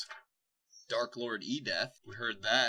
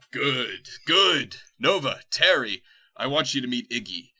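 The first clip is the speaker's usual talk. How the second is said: gleeful villain; 2 semitones higher, 9 dB louder than usual. Acted. Dark lord greets his henchman, loud and gleeful. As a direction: bark it out in triumph.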